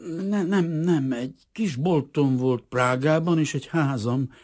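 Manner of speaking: high, loud, halting denial; flustered stammer. A narrator's reading. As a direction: stumble anxiously into explaining yourself. Nervous stranger stammers out denial. (zavartan hebegve)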